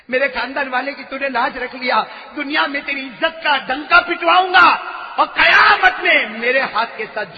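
the Prophet's words thundered out; rising shout. Acted. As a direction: build into a blessing shout. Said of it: "repeated louder".

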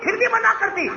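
high, exasperated protest building up; angry complaint. A narrator's reading. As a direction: list each refusal in rising exasperation. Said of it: "تھے ہی ناراض". (ناراضی سے چڑھتی ہوئی آواز)

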